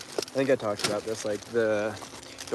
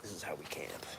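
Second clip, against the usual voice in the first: whispering; 4 semitones lower, 10 dB quieter than usual. Real, not acted. Quiet aside to viewers in tent.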